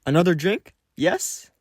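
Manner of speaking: high taunting question; mocking. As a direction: tease him with the offer.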